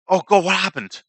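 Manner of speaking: noble prince crying out. Acted. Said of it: "Wait, that's the prince's voice".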